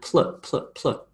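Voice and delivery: PL as short blended bursts; P released straight into L, isolated; consonant cluster. Shown as a demonstration. Teacher showing the sound pl makes, clipped cluster demo. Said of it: "p and l together".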